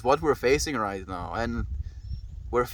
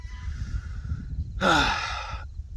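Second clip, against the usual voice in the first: long sigh; 6 semitones higher than usual. Real, not acted. Long sigh mid-sentence.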